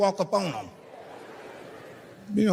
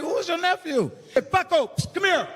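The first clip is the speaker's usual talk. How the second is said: nervous high voice; 11 semitones higher than usual. Retold or imitated. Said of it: "I'm like".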